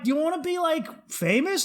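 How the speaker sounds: high-pitched mock-tempting question; imitated offer.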